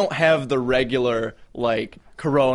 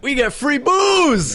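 excited; 13 semitones higher, 6 dB louder, slower than usual. Real, not acted. Excited about free alcohol.

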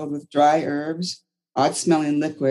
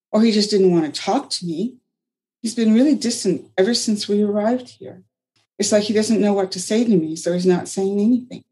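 put-on lightly raised voice of a hurt young woman; young woman Anna, hurt; 6 semitones higher than usual. A narrator's reading. Anna telling Margaret of Jared, lightly hurt. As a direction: sound wounded and a touch higher.

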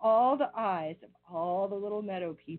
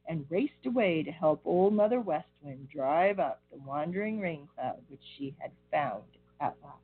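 ordinary delivery

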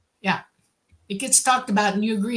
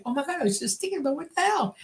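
higher-pitched mock astonishment; retold startled reaction, pitched up; imitated freak-out; 5 semitones higher, 4 dB quieter than usual. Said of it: "they freak out".

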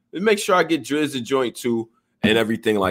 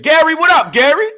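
high, loud calling out; excited, high-pitched hail; hyped shout-out; 7 dB louder than usual. Real, not acted. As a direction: holler a greeting way up high. Calling out to Gary on stream.

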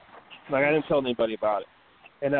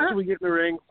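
usual speaking voice

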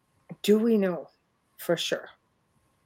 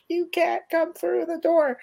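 raised, pleading voice; high and insistent; high-pitched retold warning; 8 semitones higher than usual. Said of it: "I'm like".

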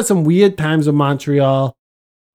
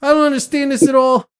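baffled exasperation; 6 semitones higher than usual. Retold or imitated. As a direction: act out giving up in confusion. Retold baffled retreat from argument.

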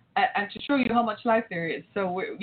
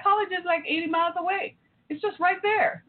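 put-on high, breezy teenage daughter voice; mother voicing her daughter's casual dismissal, pitched high; 9 semitones higher than usual. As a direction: brush it off like a carefree teen. Mother quotes daughter's reply.

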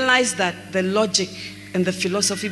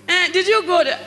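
higher, prying questions at the husband; higher mock nagging wife; 8 semitones higher than usual. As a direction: pester him for answers.

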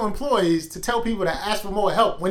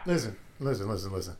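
fading hush; 11 semitones lower, 12 dB quieter than usual. Real, not acted. Hushing for emphasis.

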